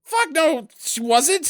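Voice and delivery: high loud protest; emphatic denial. Real, not acted. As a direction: shut the idea down hard. Heated disagreement with co-host.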